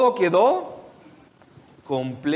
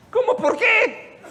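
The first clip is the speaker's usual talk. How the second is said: incredulous; slower than usual. Acted. Disciples' incredulous reply.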